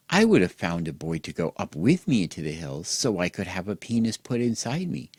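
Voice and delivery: ordinary delivery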